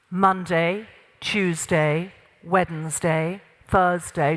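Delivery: low, weighty delivery of each day; gravitas. Shown as a demonstration. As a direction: keep the days grounded and authoritative, pitched low. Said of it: "the gravitas piece".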